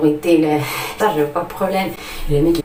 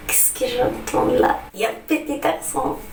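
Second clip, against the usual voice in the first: put-on teasing accent-spotter; 8 semitones higher than usual. French person spotting her accent, high teasing voice. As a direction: pitch it up like a nosy listener.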